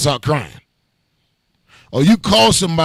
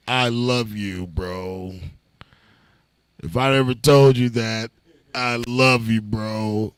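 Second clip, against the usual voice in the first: lower, soft, slow boozy love; drunk affection; 7 semitones lower, 6 dB quieter, slower than usual. Retold or imitated. Drunk friend telling him he loves him, low and slow. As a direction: croon it low like a drunk buddy.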